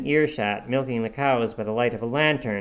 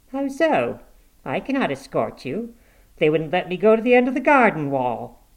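lightly voiced woman, higher pitch; indignant refusal; 6 semitones higher, 4 dB louder than usual. A narrator's reading. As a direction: raise the pitch for a character's retort. Woman answering in a dialogue.

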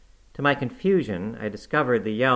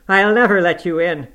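loud, higher refusing cry; angry shout; 10 semitones higher, 11 dB louder than usual. Acted. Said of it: "I shouted".

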